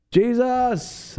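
high frantic cry of Jesus; mock panicked scream. Haitians screaming during earthquake.

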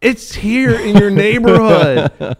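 high, loud, slow incredulous insistence; exasperated high protest. Retold or imitated. Telling the little girl her dog is still here, high and slow. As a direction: stretch it out in disbelief. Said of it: "I'm like".

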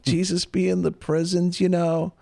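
attempted accent impression, pitched high; Francis Chan accent. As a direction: put on his accent as best you can, voice lifted. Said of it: "that's my accent. That's the best I can do".

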